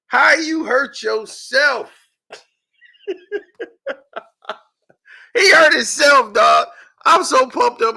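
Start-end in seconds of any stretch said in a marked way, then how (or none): 5.3-8.0 loud, delighted outburst; gleeful shout. Real, not acted. Gloating over the rival's injury, loud and delighted. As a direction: shout it out, thrilled by the news.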